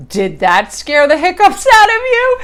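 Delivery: high, loud, slow amused question; amused waitress. Retold or imitated. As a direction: tease with delight. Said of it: "then she goes".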